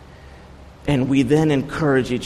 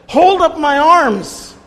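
much higher and louder, slow, crying out for help; desperate plea; 13 semitones higher, 7 dB louder, slower than usual. Retold or imitated. Priest begging the room to hold up his arms, high, loud, desperate. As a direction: beg it high and loud, like arms about to drop. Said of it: "almost tears in his eyes".

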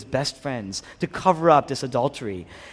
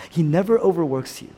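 ordinary delivery

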